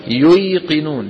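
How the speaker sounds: ييقنون said slowly with the yaa sound, the root form; original form. Shown as a demonstration. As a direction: stretch out ييقنون as the root word. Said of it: "لأنها مشتقة من اليقين". (نطق ييقنون ببطء على الأصل)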